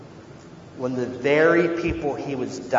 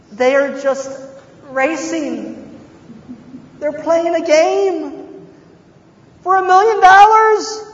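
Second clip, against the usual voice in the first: incredulous; 11 semitones higher, 4 dB louder than usual. Acted. Preacher mocks racers' complaint.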